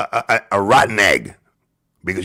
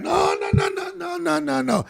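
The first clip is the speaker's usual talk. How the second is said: mocking no; 3 dB quieter than usual. Real, not acted. High mocking dismissal.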